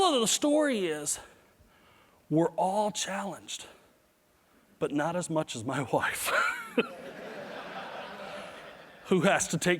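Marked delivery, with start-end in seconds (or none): 6.4-8.4 breathy laugh. Real, not acted. Laughing at own joke.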